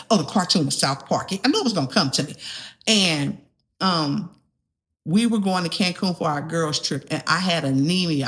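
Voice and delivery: ordinary talk, nothing marked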